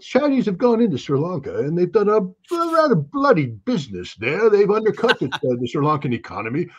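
high, mock-British old chap; hammed-up British toff, pitched high; British accent. Redoing the Sri Lanka claim to sound smart, mock British accent. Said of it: "somebody with a British accent".

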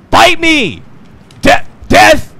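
high, loud challenge; battle cry. Real, not acted. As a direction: shout a dare at them. Challenging enemies.

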